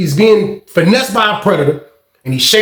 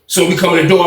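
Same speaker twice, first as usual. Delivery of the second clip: ordinary delivery, nothing marked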